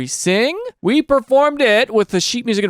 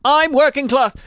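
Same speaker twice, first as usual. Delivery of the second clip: put-on British accent; 7 semitones higher than usual. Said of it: "they'll talk about each other like".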